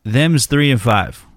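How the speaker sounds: mimicking drawl. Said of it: "repeats caller".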